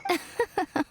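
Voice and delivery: high teasing chuckle; light knowing laugh; sly amused laugh. Acted. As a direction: laugh off their worry, smug. Miko amused by worry.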